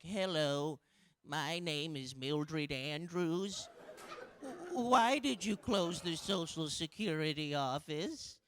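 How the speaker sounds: slow, lightly voiced sweet old lady; a hint of a frail old lady asking politely; little old lady. Comic voicing an old lady at a town hall, lightly and slowly. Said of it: "a nice little old lady".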